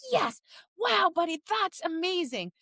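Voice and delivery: mock gushing at a dog; over-the-top praise. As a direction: play your own overexcited praise. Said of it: "I'm a lotta dog".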